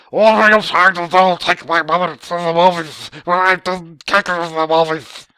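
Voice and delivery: loud, high mock bellowing; hammed-up furious shouting; imagined rage. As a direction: yell like an outraged man-baby. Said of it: "just went into a rage".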